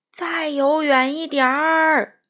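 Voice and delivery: loud, slow booming call; whale calling. Acted. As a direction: call out across the sea. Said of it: "鲸鱼叫着".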